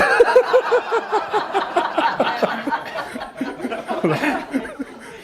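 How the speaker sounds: a long, breathy burst of laughter; airy laughter. Real, not acted. Amused by his own joke to the audience, airy laughter. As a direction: let the laugh run out on the breath.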